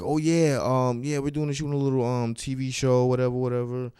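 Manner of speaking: high, casual imitation of a crew guy; imitating the crew guy. The crew guy tells the comic about the Hulu show, in a high casual voice. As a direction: play the guy casually explaining the shoot. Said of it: "the guy goes, he's like".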